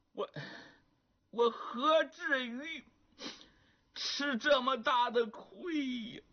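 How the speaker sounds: put-on tearful young man. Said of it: "这眼泪还掉下来了".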